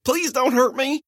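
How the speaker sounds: high, whimpering plea; pleading old man. Acted. Old man pleading with his attacker, high whimper. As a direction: beg for mercy, pitched high.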